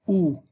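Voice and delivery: ou as one "oo" vowel, set apart; pronunciation demo. Shown as a demonstration. Teacher telling class it is always pronounced so, ou as "oo". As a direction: say ou as a single "oo". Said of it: "ننطق دائمًا".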